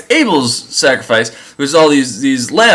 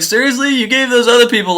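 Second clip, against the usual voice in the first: higher, outraged disbelief; indignant; 8 semitones higher than usual. Retold or imitated. Adam complaining to God about his curse, indignant and higher. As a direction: protest the unfairness.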